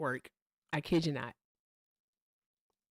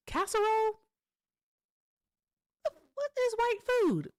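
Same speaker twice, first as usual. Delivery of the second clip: high, mocking squeak.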